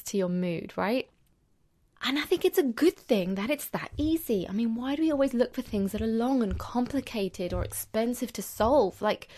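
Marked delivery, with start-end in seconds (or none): none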